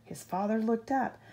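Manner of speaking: plain reading voice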